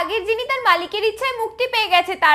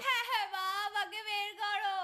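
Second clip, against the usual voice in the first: faint, slow, lower plea; faraway trapped voice; 4 semitones lower, 16 dB quieter than usual. Acted. Trapped daughter asks to be let out, distant and faint. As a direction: plead faintly from inside the lamp. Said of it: "মনে হচ্ছে যেন অনেক দূরের আওয়াজ".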